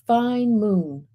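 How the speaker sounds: plain reading voice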